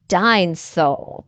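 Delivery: a hint of small George; mild calling out. A narrator's reading. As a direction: draw the word out like a little boy. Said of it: "she called".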